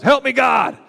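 loud, high shout for help; drowning man's yell. Retold or imitated. Preacher imitates a drowning man, shouting for help. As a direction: yell it like you're going under. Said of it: "you never hear them yell".

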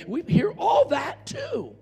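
high, drawn-out shout; rising cry from the pulpit; exclaiming. Real, not acted. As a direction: burst out high and indignant, stretching the words. Bursts out rebutting the enemy.

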